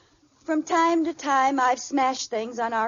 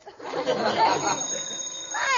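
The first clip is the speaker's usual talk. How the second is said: breathy laugh. Acted. Wife chuckling at her own mistake. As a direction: chuckle at herself.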